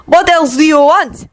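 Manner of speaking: high, resentful outburst; angry retort. Acted. Victor snaps at Yuri, anger resurfacing. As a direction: throw the apology back. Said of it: "Victor spat, his anger resurfacing".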